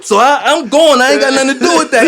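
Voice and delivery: half-acted shouting match; retold heated reply. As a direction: snap back defending yourself.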